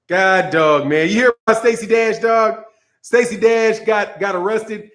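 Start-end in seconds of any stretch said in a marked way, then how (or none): none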